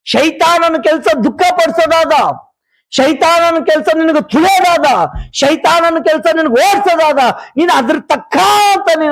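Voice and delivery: high, rhythmic sermon refrain; insistent preacher hammering a repeated phrase; warning preaching. Real, not acted. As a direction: drive each warning home with lifted pitch.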